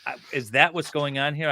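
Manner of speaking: usual speaking voice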